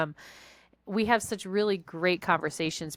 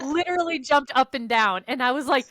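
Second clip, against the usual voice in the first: laughing; 6 semitones higher, 5 dB louder than usual. Real, not acted. Laughing while telling.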